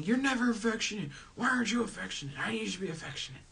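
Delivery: high, rushed mock nagging; nagging complaint. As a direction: nag your partner in a high, hurried voice. Acted nagging complaint to partner.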